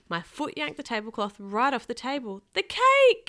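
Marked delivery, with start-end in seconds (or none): 2.5-3.3 high, loud cry of alarm; yelled. A narrator's reading. Kenny sees the cake flying, high alarmed yell. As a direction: yell it out in panic. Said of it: "Kenny yelled".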